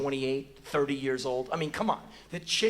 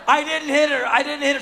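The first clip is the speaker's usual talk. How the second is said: high, loud defensive cry; protesting innocence; 10 semitones higher, 9 dB louder than usual. Retold or imitated. Accused man drying his nails, loud defensive protest. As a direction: plead innocence at the top of your voice.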